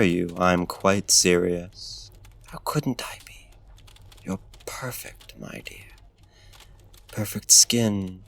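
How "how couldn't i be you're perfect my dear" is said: hushed, close whisper of flattery; seductive whisper. Acted. Flatters the captive up close, hushed whisper. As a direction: breathe it into her ear.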